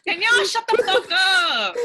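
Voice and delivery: high, loud telling-off; exasperated yell. Real, not acted. Podcaster reining in tearful co-hosts, loud and exasperated. Scolding friends derailing the podcast.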